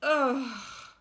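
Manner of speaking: weary drawn-out groan; children's groan. Acted. Children groaning at their extra work, weary. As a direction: groan like bored kids. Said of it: "groaned the children".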